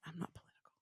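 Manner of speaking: whispered refusal. Retold or imitated. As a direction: whisper it like a secret confession. Retold refusal to meet candidate.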